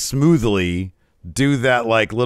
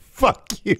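high squeaky mock voice reading tiny text; high piping mock of the tiny message; 12 semitones higher than usual. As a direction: read it like tiny writing talking back. Imagined tiny rude writing.

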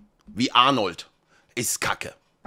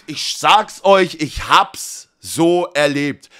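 slow, emphatic; much slower than usual. Real, not acted. Stressing his point slowly.

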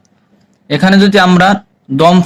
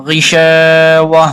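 drawn-out melodic tilawat, each vowel held long; slow Quran recitation; much slower than usual. Shown as a demonstration. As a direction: recite slowly like a tajweed teacher. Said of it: "teacher models recitation". (ধীর সুরেলা তিলাওয়াত)

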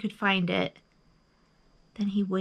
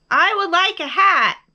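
high, loud answer; big high-pitched bear voice; calling out; 8 semitones higher, 9 dB louder than usual. A narrator's reading. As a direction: call it out to someone far away. Bear calls across to the moon.

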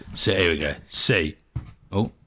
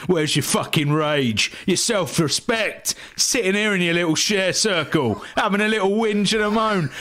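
pitched-up tough-guy rant, slowing on key phrases; Billy Butcher; 5 semitones higher than usual. Acted. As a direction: build from a chuckling start into a high scornful tirade. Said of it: "do a Billy Butcher quote".

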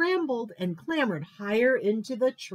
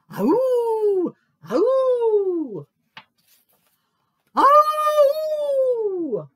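very high, loud howl; drawn-out falling howl; awful howl; 8 dB louder, much slower than usual. A narrator's reading. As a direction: howl it out, the last one longest. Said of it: "he heard an awful howl".

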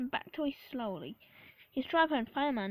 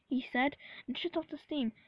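plain reading voice

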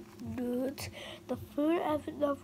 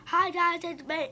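loud, raised-pitch greeting; louder intro on request; talking louder; 4 semitones higher, 7 dB louder than usual. Real, not acted. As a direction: belt out the hello so it is heard. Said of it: "Can you talk louder?".

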